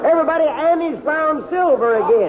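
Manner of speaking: high, excited calling out to the crowd; excited shout. Acted. Doc telling the courtroom Annie found silver, excited high call. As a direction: rouse the whole room with the news.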